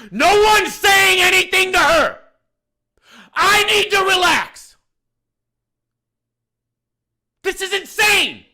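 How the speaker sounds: exasperated shouting at the whole bar; real angry yelling. Real, not acted. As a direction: yell it out in real outrage. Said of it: "I'm sorry for yelling".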